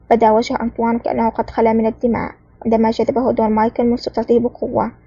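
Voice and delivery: ordinary delivery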